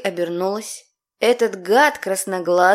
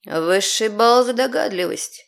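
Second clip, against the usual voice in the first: ordinary delivery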